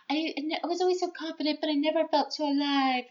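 high, fast, breathless overacted gush; hammed-up swoony teen vampire; mock dramatic heroine. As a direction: parody a melodramatic heroine. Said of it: "or some bullshit".

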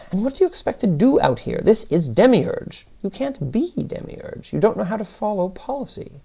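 put-upon official, pitch well up; exasperated Demiurge. Acted. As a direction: lecture the intruder with swooping, stretched emphasis. Demiurge lectures Paquette, exasperated.

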